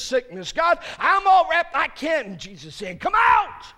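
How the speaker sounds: high, loud, pleading preacher's cry; full-force preaching. Acted. Preacher voices a troubled believer pleading with God, loud.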